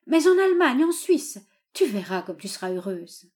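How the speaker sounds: high, loud, fervent coaxing of a daughter; desperate father's entreaty; pleading old father. A narrator's reading. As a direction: beg her emphatically, voice lifting.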